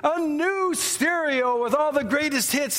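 high, drawn-out prize list; game-show announcer. Retold or imitated. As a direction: build up each prize like a TV host. Acting the announcer listing more prizes.

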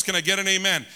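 usual speaking voice

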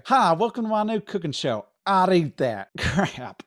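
host intro. Acted. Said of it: "not I'd Eat That Crap".

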